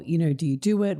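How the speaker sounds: ordinary talk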